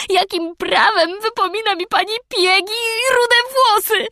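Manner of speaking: put-on high, loud enraged child; furious girl. A narrator's reading. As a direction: hurl it at the old woman. Anne's furious outburst.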